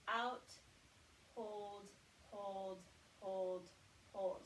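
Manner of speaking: slow paced breath counting, each word drawn out; counted breath cues. Shown as a demonstration. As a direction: lead the count like a breathing coach. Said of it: "So it will sound like this".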